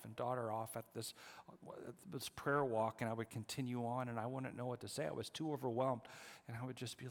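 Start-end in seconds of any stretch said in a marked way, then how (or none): none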